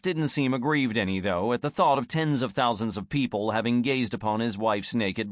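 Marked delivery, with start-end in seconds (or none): none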